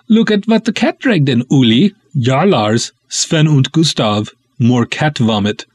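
high, hammed-up Scandinavian officer teasing; mock a lazy colleague in a thick Scandinavian accent. Lazy desk cop mocks late arrivals in Scandinavian accent. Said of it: "way too fun doing those ridiculous accents".

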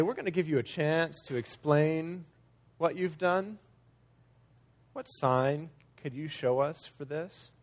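soft, measured, slyly polite question; sneaky, smarmy. Acted. Leaders giving Jesus a chance to explain, smarmy. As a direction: feign politeness over a veiled challenge, softly. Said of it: "a little cryptic and a little sneaky and a little smarmy".

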